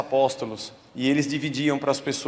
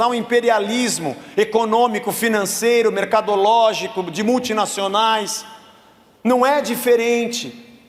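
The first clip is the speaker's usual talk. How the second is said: preaching at full force, louder and higher; fervent preaching; 6 semitones higher, 4 dB louder than usual. Acted. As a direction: drive the sermon home with fire. Preaching to congregation. (pregação inflamada, mais alta e mais aguda)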